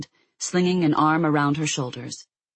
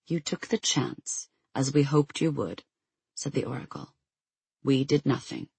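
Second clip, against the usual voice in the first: hushed oracle voice; 5 dB quieter than usual. A narrator's reading. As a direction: speak it like a quiet ancient oracle.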